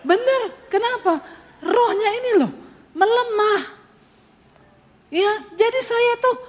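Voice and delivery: high, cracking half-sob half-laugh; teary high laugh while preaching; laughing through tears. Real, not acted. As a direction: voice breaking with laughter and crying. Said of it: "kayak orang kena stroke".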